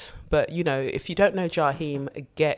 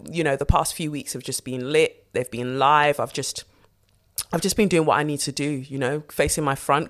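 ordinary delivery

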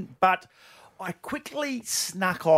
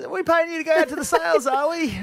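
higher, fast sarcastic boss voice; 11 semitones higher than usual. Retold or imitated. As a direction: needle him like a sly boss. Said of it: "he goes".

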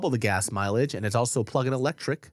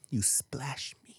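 put-on slow, soft, sulky kid whisper; 2 semitones higher, 9 dB quieter, much slower than usual. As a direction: whisper the accusation like a hurt child. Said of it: "He goes".

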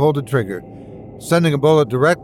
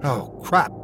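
usual speaking voice

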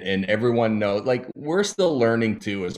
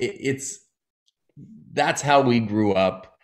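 ordinary delivery

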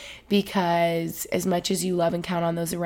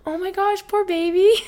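very high-pitched cooing over-sympathy; gushing pity; 12 semitones higher, 4 dB louder than usual. Retold or imitated. Student remembering writing about wounded deer, gushing imitation.

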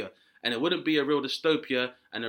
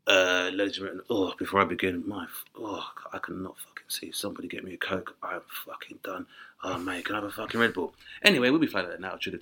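low, mumbled, hungover pilot announcement; wrecked pilot; 8 semitones lower, faster than usual. Retold or imitated. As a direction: slur it like a pilot who partied all night.

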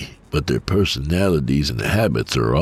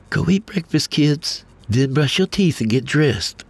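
higher, lightly voiced mom giving orders; wife instructing; 12 semitones higher than usual. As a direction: send the kids off like a brisk mother. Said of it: "she instructed".

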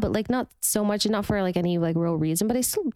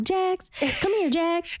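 put-on much higher Southern accent calling out; Brittany; 9 semitones higher, slower than usual. Cast member imitating castmate calling Jax, high Southern accent. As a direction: mimic a Southern woman calling her man. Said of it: "Brittany's is easy 'cause she'd be like".

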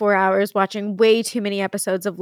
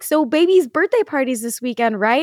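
lightly voiced kid, higher and louder; imitated child's question; 5 semitones higher, 5 dB louder than usual. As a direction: ask eagerly like an expectant child. Retells Jack's innocent question.

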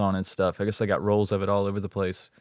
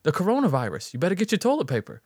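high, quick mimicked Northeast accent; 7 semitones higher than usual. Shopper warning a stranger about the virus, imitated Northeast accent. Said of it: "she definitely had that accent".

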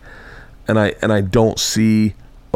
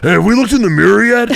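big brain voice; 11 semitones higher, 5 dB louder, faster than usual. Retold or imitated. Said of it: "He's like".

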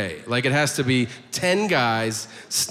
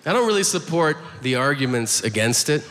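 ordinary talk, nothing marked

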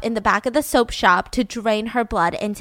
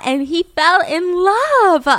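gushing matchmaker; 8 semitones higher, 6 dB louder, much slower than usual. Retold or imitated. Leonardo selling the match.

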